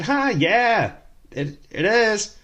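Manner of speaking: awkward agreement. Retold or imitated. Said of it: "And I said".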